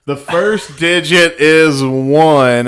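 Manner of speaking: slow loud dictation; dictating. Retold or imitated. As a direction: read each digit out carefully. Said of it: "I'll start all over".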